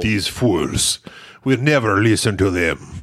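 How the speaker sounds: low, put-on Russian accent from a movie; mock Russian accent. Joking to co-host in a mock Russian accent. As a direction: ham up a bad Russian accent, dropping the voice low. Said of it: "that guy's Russian accent".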